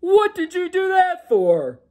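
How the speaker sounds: a sleepy giant ogre grumbling to his wife; loud ogre brushing off his wife's worry. Acted.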